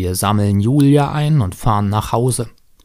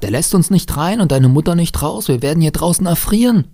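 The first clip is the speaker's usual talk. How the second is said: raised, higher, pleading exasperation; exasperated protest; 8 semitones higher, 4 dB louder than usual. A narrator's reading. Stepfather to stubborn boy in the cold, exasperated. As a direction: argue it with growing urgency.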